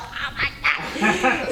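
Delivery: slow, breathy laughing disbelief; stunned laugh. Retold or imitated. Asked for her measurements, laughing in disbelief.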